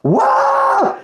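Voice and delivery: stunned disbelief at the accent; amazed. Real, not acted. Amazed by the assistant's English accent. (stupéfait, très aigu)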